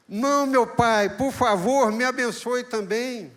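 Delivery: loud, high, desperate begging; pleading cry. Acted. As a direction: beg your father for the blessing, near tears. Esau begging his father. (Esaú implorando ao pai, voz alta e desesperada)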